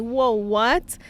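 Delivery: a startled whoa, voice jumping up; stunned surprise. Retold or imitated. Couple hearing the sudden appointment date, startled. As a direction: caught completely off guard.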